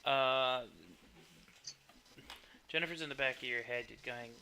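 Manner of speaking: ordinary talk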